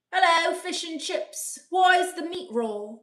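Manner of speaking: playful mock British accent. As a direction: put on a jokey British accent. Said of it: "The British accent was quite good".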